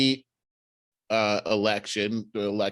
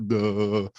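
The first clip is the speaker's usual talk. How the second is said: mock dumb. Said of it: "just standing around like".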